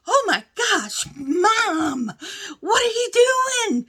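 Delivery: high, loud mock alarm; shocked son. Son finds mom upside down in the washer, mock shock.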